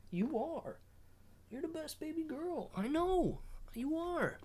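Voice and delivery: high, soft cooing. Real, not acted. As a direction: coo adoringly in a tiny high voice. Baby talk to baby girl.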